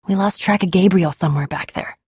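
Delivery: ordinary delivery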